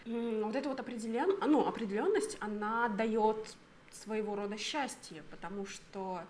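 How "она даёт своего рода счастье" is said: normal talking voice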